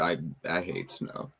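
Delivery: normal talking voice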